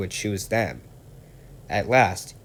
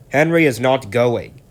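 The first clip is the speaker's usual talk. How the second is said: loud, higher rude retort; 4 semitones higher, 8 dB louder than usual. Acted. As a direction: snap it back insolently. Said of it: "said Gordon rudely".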